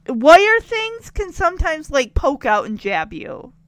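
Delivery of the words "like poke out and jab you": ordinary talk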